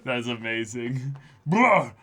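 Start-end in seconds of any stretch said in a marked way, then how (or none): 1.4-1.9 higher put-on villain line; hammed-up villain. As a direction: growl it like a baddie. Voicing Cracker line.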